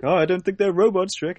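dawning worry, hammed-up; Morty impression. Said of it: "And he's like".

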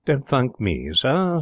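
indulgent butler. A narrator's reading. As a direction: wave away thanks indulgently. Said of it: "said the butler indulgently".

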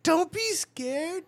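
drawn-out high teasing purr; slow and high, coaxing him; very slow high mock-seductive voice; high slow hooker voice.

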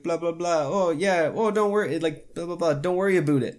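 light, mocking Canadian accent; imitated Canadian lady. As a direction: mimic a Canadian lady saying "about" as "aboot". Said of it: "on the phone with a lady today".

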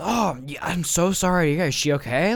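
loud, high, over-concerned neighbor; Martin. Acted. Neighbor hears about the injured aunt, gushing concern.

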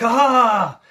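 high-pitched, hammed-up eager outburst; mock manic excitement. Retelling Majima vowing to get his fight, mock manic glee. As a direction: imitate a nutter seizing on an idea. Acting out Majima's gleeful vow.

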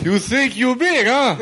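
hammed-up Russian tough guy; Russian accent. Friend taunting in the accent game, mock Russian accent. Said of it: "Russian, Russian".

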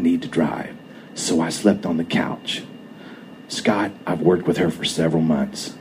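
quietly reassuring the angry husband, almost whispering; calm quiet voice. A narrator's reading. Soft calm explanation to Scott so he stays calm. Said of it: "calm, quiet, almost whisper of a voice".

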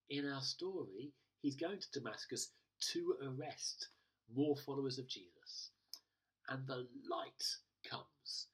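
normal talking voice

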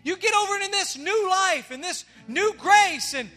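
loud, rising preacher's call to the crowd; full-force preaching. Acted. Pastor preaching forgiveness to his church, loud and fervent. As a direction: preach it out to the congregation.

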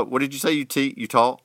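normal talking voice

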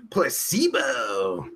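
drawn-out word with a laugh; playful drawl. Real, not acted. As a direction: stretch it slowly, savouring the sound. Savours the word for fun.

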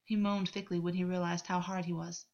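usual speaking voice

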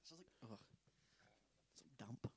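soft whispered aside; whispering. Real, not acted. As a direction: breathe it out under your breath. Muttered aside, unvoiced.

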